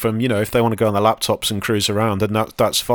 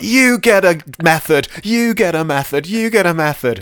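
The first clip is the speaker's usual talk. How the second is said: Oprah; 9 semitones higher, 5 dB louder than usual. Acted. Handing out code samples like prizes to the audience, hammed-up Oprah. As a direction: holler it out, stretching each line high and loud. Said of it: "then being like Oprah".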